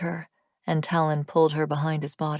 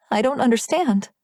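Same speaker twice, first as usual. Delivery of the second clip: higher, louder, frightened plea; panicky; 6 semitones higher, 4 dB louder than usual. Acted. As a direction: let panic break into the words. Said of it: "Panic crept into her voice".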